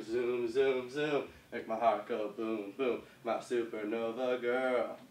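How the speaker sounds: slow, rhythmic half-chant; slow lyric recital. Shown as a demonstration. Dance teacher running the moves with the music's words, slow rhythmic recital. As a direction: chant the words evenly, drawn out to the beat.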